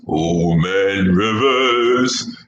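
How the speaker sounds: put-on low, very slow Old Man Rivers; deep voice. Shown as a demonstration. Host booms the nickname in deep voice. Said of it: "my deep voice for something".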